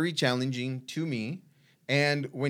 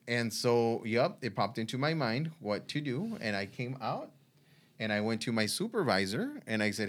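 normal talking voice

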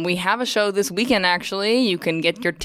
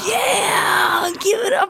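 excited whisper-shout; 13 semitones higher, much slower than usual. Acted. Guest hypes herself, whispered shout.

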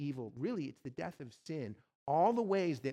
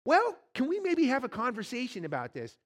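higher, louder nervous bargaining; pleading ghost; 8 semitones higher, 6 dB louder than usual. Acted. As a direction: talk fast to stall the angel.

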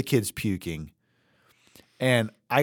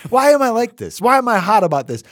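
higher, louder fast self-reproach; exasperated outburst; 8 semitones higher, 5 dB louder, faster than usual. Retold or imitated. Parent frustrated at sick kid, higher and louder, quick. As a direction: scold yourself for getting hot about it. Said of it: "No, I'm like".

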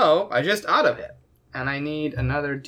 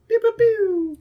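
high cartoon zap sounds; pew pew sound effect. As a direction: fire off little laser noises. Imitating magic missile shots.

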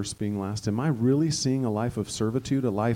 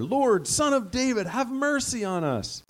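pitched much higher, crying out for mercy; higher pleading cry; 10 semitones higher than usual. A narrator's reading. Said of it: "they shouted all the louder".

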